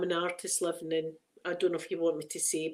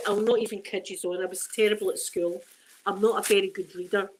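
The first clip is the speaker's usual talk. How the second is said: usual speaking voice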